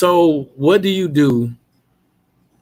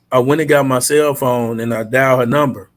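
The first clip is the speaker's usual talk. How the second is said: ordinary delivery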